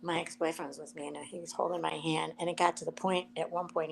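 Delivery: ordinary talk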